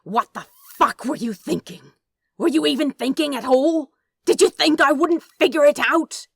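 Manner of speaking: Crowley yells at the angel, climbing to a roar; furious shout. Acted. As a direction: build from angry to full-throated fury.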